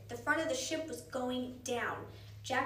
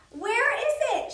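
high, loud panicked cry; frantic shout; 11 semitones higher, 11 dB louder than usual. A narrator's reading. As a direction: shout it out in alarm. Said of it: "shouted Annie".

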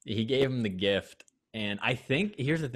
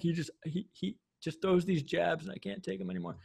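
higher, mock-whiny complaint; whiny victim; 8 semitones higher, 4 dB quieter than usual. Mocking Noah as he complains to Tayshia, lightly whiny and high. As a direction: play the wounded victim, lightly. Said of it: "he's like".

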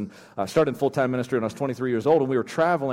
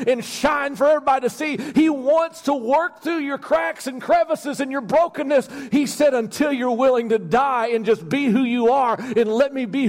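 high-pitched, relentless sermon delivery; fervent preaching, voice pitched way up; impassioned preaching; 10 semitones higher, 3 dB louder than usual. Real, not acted. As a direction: drive it home from the pulpit with urgency. Preaches at full force.

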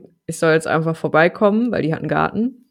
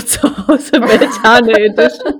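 laughing; 6 semitones higher, 7 dB louder, slower than usual. Pretend. Mock sponsor announcement.

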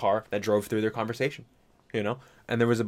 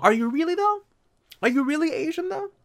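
high, doubting question; sceptical challenge. Acted. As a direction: press them on it, disbelieving. Challenging a claim.

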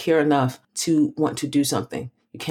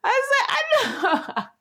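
real laughter, much higher pitched; laughing; much slower than usual. Real, not acted. Co-host caught out by her friend, laughing high. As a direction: squeal it through giggles.

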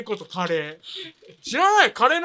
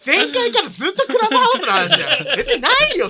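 laughing protest; 11 semitones higher, 7 dB louder than usual. Real, not acted. Protests at returning to topic.

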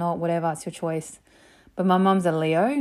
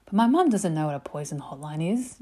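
ordinary talk

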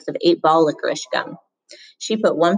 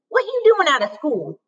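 scolding woman voice; 11 semitones higher than usual. Acted. Willie Mae scolding Rob.

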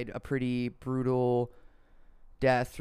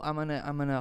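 ordinary delivery

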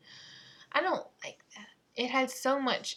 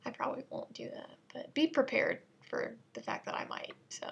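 whispered aside; 3 semitones higher, 4 dB quieter than usual. Real, not acted.

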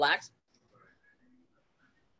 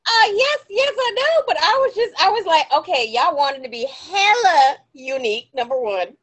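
laughing rant; 4 semitones higher, 7 dB louder than usual. Real, not acted. Ranting laughingly about baby names.

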